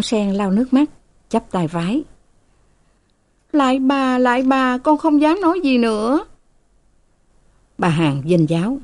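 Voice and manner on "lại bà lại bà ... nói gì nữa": put-on high, pleading young maid. A narrator's reading. Frightened maid begs her mistress to save her, high and pleading. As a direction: beg the mistress for help in a high voice.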